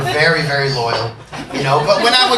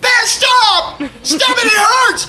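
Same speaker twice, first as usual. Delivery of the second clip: much higher, louder yell of pain; 5 dB louder, much slower than usual. Retold or imitated. Teen screaming at friend to stop the painful tattoo.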